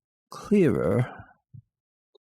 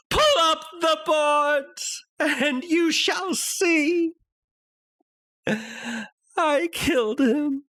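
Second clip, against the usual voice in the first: high, shouted, desperate cry; crying out to the policemen, hysterical shout; frantic confession; 13 semitones higher than usual. Acted. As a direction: scream out the guilt. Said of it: "and I cried".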